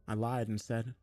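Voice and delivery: ordinary delivery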